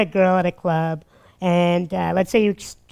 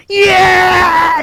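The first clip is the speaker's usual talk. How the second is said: loud high victorious shout; triumphant yes; 9 semitones higher, 8 dB louder than usual. Retold or imitated. Locked in the room by the girl, shouting triumphantly. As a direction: yell your joy. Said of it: "no greater feeling on the planet".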